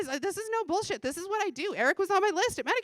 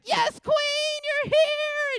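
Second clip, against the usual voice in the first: much higher and louder hype greeting; gushing greeting; 11 semitones higher, 9 dB louder, much slower than usual. Retold or imitated. Imitating Andrea greeting someone at the door, high and gushing. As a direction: squeal it like a hype friend welcoming you.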